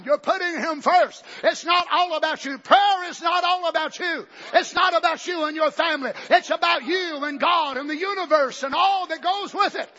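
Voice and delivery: fiery preaching. Acted. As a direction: drive it home from the pulpit. Preaching at full force.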